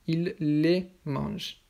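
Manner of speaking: "il les mange" slow, weight on "les"; emphasis on LES. Shown as a demonstration. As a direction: stretch out "il les" for learners. Teacher models plural pronoun. (insister lentement sur « les »)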